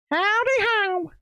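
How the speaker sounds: squeaky greeting. Pretend. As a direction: pop up high and bright to greet the gang.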